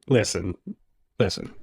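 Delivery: low, softened voice, pausing between words; measured retold reply. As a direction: drop low and soft to steady the listener. Retold careful reply to her question.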